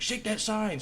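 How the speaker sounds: high excited urging; egging on. Retold or imitated. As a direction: hype up a drunk friend. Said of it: "your buddy's like".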